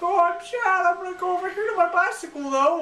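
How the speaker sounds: put-on very high, loud child voice; mock a sad little girl, squeaky and quick. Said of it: "And the little girl is like".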